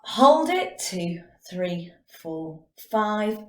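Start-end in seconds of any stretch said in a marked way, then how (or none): 0.0-0.8 emphatic. Shown as a demonstration. Breathing exercise cue.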